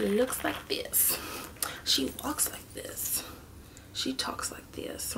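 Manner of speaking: slow, fading into a soft whisper; playful sultry bit. Pretend. Joking to viewers about the Seductress shade, slow and hushed. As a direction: tease like a seductress, dropping to a whisper.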